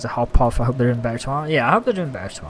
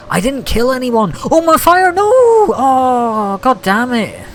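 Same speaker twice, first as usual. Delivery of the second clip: loud, high wail of dismay; panicked shout; 10 semitones higher, 7 dB louder, slower than usual. Real, not acted. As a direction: cry out as the fire dies. Game fire goes out mid-stream.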